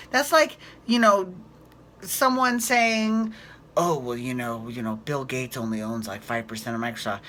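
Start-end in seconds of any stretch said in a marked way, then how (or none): 3.8-7.2 mocking voice. Mocking a dismissive rich person.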